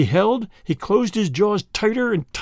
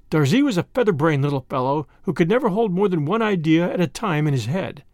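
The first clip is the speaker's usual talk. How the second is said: plain reading voice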